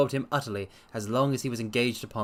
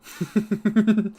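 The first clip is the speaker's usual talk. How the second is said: loud amused laugh; 10 semitones higher, 7 dB louder than usual. Real, not acted. Laughs at poetic phrasing.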